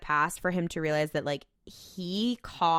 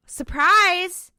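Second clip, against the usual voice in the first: loud, high, sing-out exclamation; spring it on them, high and loud; mock gleeful reveal; 11 semitones higher, 9 dB louder than usual. Demon revealing himself in Samuel's body, mock gleeful shout.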